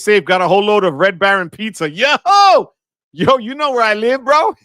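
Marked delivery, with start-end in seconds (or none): 1.9-4.5 loud, high excited shout; excited yell. Real, not acted. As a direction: burst out like a friend just showed up. Excited reaction to pizza comment.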